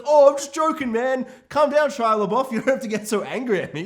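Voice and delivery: high, loud mock placating; imitating a prankster. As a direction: play the joker talking down an angry friend. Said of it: "and then he's like".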